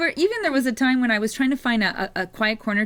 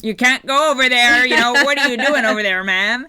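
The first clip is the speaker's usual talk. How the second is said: louder, bossy mock scolding; mock rude guard; 7 dB louder than usual. Imagined rude guard ordering a tourist away, mock loud scolding. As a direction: bark it like a rude guard who's fed up. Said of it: "Rather than just saying".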